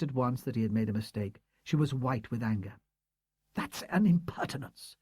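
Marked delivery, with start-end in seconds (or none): 3.5-5.0 indignant cry. A narrator's reading. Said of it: "she cried".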